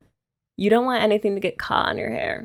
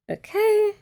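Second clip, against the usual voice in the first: high okay; 14 semitones higher than usual. Real, not acted. Cheery okay after spraying.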